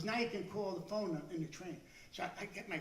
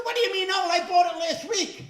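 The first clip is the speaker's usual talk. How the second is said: outraged yell; 10 semitones higher, 13 dB louder than usual. Retold or imitated. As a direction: protest loudly to your friend. Outraged at the friend.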